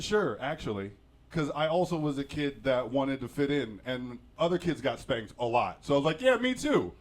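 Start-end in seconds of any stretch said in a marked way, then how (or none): 6.2-6.9 high-pitched, eager agreement; eager kid chiming in. Retold or imitated. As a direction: pipe up high, desperate to fit in. Child eagerly claiming to fit in.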